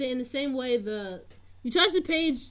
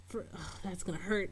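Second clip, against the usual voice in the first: ordinary talk, nothing marked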